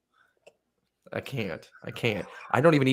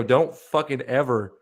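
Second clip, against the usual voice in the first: retold angry warning; 6 semitones higher, 8 dB louder, slower than usual. Retold warning, laughing as told.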